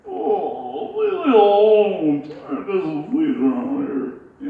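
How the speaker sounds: high, loud, slow, muffled; grumpy puppet shut in a box. Shown as a demonstration. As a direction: groan from inside the trunk. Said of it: "finally he just says".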